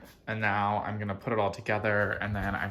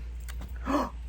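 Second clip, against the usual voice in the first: excited high gasp; 5 dB quieter than usual. Real, not acted. Sees the dogs.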